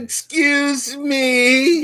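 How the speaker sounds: high, loud, long drawn-out words; mock indignation. Genesis owner answering doubters, drawn-out mock outrage. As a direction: stretch it out as if deeply offended.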